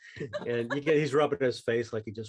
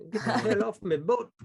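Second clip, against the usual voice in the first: a Scottish captain roaring at the chaos on his boat; ship captain in a Scottish accent; 10 semitones higher, 6 dB louder than usual. Acted. GM voicing the Scottish captain, who yells at the brawlers to get off his boat. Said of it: "like that crazy guy from The Simpsons".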